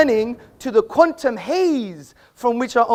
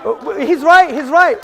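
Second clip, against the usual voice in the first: shouted concession; 5 semitones higher, 10 dB louder than usual. Real, not acted. Heckler's joke, speaker concedes.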